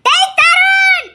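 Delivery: high, long-drawn shout; excited high call. Acted. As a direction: holler the name, stretching it out. Calling out to Tharun.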